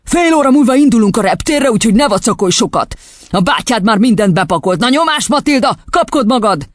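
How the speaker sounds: high-pitched, pushy ordering; impatient father. A narrator's reading. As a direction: hurry the child along crossly. Father hurries his daughter along.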